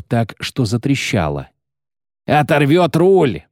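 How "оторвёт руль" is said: loud, high, drawn-out alarm cry; strained yell. A narrator's reading. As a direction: yell it across the deck. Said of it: "надрывался подларак". (надрывный крик)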